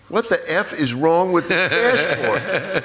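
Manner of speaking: a mother's appalled, drawn-out scolding; mother yelling about the dashboard. Retold or imitated. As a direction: drag out the furious question like a shocked mom. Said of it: "She looks and goes".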